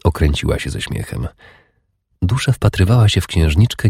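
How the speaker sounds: ordinary delivery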